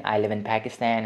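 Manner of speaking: "Pakistan" in an English accent with flat a vowels, PAK-i-stan. Shown as a demonstration. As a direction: say "I live in Pakistan" the anglicised English way. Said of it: "I said this in English accent".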